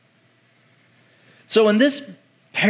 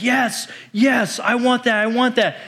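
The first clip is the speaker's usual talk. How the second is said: high-pitched, eager acted yeses; acted eagerness; 9 semitones higher than usual. Churchgoer reacting to good preaching, acted high eagerness. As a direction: lap it up like a keen listener.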